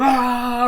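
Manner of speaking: high, loud shrieking mock scream. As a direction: shriek like teens goofing in a hallway. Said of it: "these fucking idiots going".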